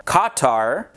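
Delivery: Qatar said as KAH-tar; variant Qatar pronunciation. Shown as a demonstration. Listing common ways to say Qatar, pronounced KAH-tar. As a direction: give both syllables of Qatar a full "ah". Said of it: "common ways of pronouncing it are".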